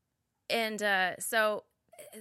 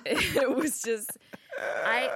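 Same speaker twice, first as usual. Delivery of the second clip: laughing through the words; 7 semitones higher, 4 dB louder, much slower than usual. Real, not acted. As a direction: break up laughing mid-sentence. Laughing at stressful memory.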